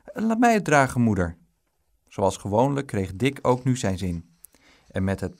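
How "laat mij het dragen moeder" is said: put-on high, loud little boy's demand; high insistent plea, dropping at the end; pleading child. A narrator's reading. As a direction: beg loudly in a high child's voice. Boy begs mother to let him.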